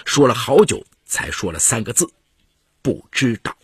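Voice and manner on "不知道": understated, halting admission through tears; a touch of crying in the words; reluctant, mildly tearful answer. A narrator's reading. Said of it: "她突然哭了起来".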